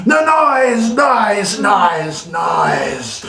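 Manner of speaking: starts high and loud, dropping down each time; shout fading. A narrator's reading. Reader's rant winding down about the noise.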